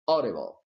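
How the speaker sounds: "audible" with the first syllable stressed; AU-dible. Shown as a demonstration. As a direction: lean on AU in "audible". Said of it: "the stress of the word is here".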